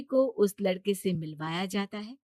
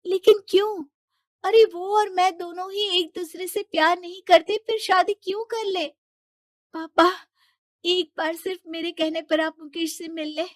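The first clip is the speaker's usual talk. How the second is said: much higher, louder desperate pleading; Shilpi distraught; 11 semitones higher, 6 dB louder than usual. Acted. Daughter to father refusing the marriage, high desperate plea. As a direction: cry out why in disbelief.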